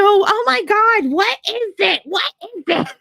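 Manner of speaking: put-on high, slow, puzzled old mother; a mother peering at a strange gift; mother opening the gift in front of family, high and puzzled; imitating mother.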